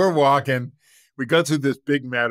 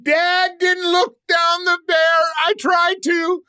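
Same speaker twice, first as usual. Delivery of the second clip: put-on high, loud indignant boy reporting to mom; upset kid; 6 dB louder than usual. Whiny high child shouting. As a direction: son complaining to mom about dad fleeing, high loud child voice. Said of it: "He goes".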